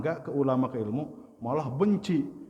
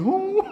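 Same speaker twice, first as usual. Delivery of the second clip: high, loud mock sob; imitated crying wail; 8 dB louder than usual. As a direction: burst into exaggerated mock crying. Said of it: "Nangis lagi". (tiruan tangis keras melengking)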